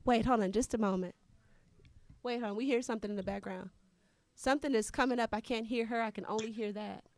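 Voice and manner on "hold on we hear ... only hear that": usual speaking voice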